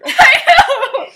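loud, high burst through laughter; laughing agreement. Real, not acted. To a friend sharing the joke, loud laughing agreement. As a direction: exclaim it while cracking up.

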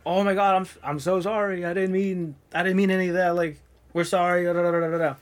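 mild impression of the panicked light guy begging forgiveness; frantic apology. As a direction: rush out the sorry like a scared kid. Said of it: "starts freaking out and starts apologizing".

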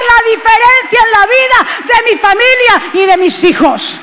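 preacher roaring a personal vow; preaching at full force. Acted. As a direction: shout the resolve, rising to a peak. Congregation declaration, building to shouting.

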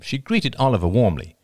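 ordinary delivery

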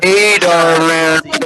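drawn-out sweet "hey darling"; slow, loud, teasing sweetness; mock sweet greeting. Mock greeting over call.

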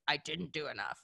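low, soft, harsh self-judgment; nasty inner voice. Retold or imitated. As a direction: let the inner critic sneer quietly. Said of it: "that nasty voice that's like".

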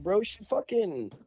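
ordinary talk, nothing marked

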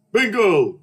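high, loud winner's shout; calling bingo. Retold or imitated. Player announces first win to host, loud call. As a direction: sing out the win at full voice. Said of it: "he goes the first bingo".

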